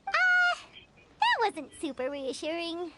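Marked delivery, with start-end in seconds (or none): 0.1-0.6 high, loud startled "uh". Acted. Player unnerved by the game master's answer, startled. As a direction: yelp it in alarm. Taken aback by "no promises.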